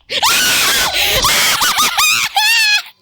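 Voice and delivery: high screaming laugh; shriek-laugh in fright; panicked shriek laugh. Real, not acted. Jump scare from Granny.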